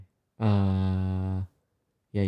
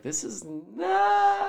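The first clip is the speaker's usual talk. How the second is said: stretched word climbing very high; long rising wail of protest; drawn-out outburst; much slower than usual. Retold or imitated. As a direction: let it slide way up and hang there. Draws out a disgusted reaction quote.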